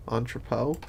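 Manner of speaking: "Entrepot" as ON-truh-poh, said softly; corrected pronunciation. Shown as a demonstration. Gamer settling on the word, "Entrepot" as ON-truh-poh. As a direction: repeat "Entrepot" softly with a short "truh" middle. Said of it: "not entrepot".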